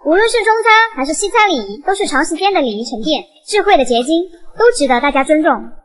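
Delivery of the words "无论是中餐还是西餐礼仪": ordinary talk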